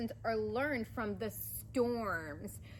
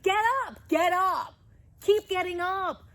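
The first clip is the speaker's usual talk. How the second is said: louder, higher rallying cry; pumped-up coach yelling get up; 5 semitones higher, 9 dB louder than usual. Real, not acted. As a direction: fire them up to get back on their feet. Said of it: "And I know I get loud".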